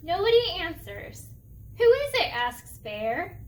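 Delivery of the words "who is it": loud, high call. Acted. Bear asks loudly.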